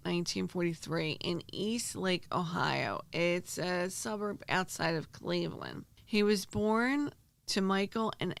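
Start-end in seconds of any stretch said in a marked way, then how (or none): none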